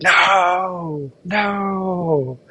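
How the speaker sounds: high, drawn-out mock wail; Armin's mock scream. Armin watching Eren get eaten, mock screaming. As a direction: imitate a horrified scream without real volume. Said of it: "like, screaming".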